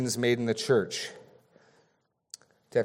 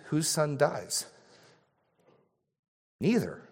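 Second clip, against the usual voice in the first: ordinary talk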